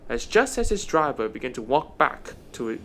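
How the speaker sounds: ordinary delivery, nothing marked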